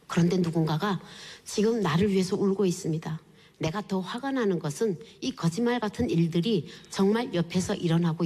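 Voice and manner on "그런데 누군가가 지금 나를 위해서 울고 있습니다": normal talking voice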